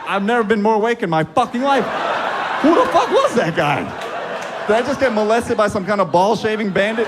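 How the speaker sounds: high-pitched indignant yelling; hammed-up outrage. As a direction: protest in comic disbelief. Acted outrage at the doctor.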